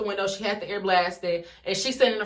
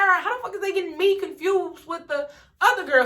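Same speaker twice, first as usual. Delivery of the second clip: high, disbelieving rant; can't believe they're confusing her; incredulous; 7 semitones higher than usual. Retold or imitated. Disbelief at mixed-up suspect.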